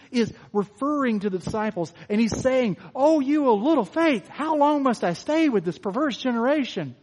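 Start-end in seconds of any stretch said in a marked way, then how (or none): 2.9-6.9 exasperated rebuke. Shown as a demonstration. Jesus rebuking the disciples who failed, exasperated. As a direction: sound fed up with them. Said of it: "could be a criticism".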